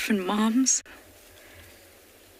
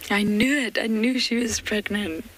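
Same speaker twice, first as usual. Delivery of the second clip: ordinary delivery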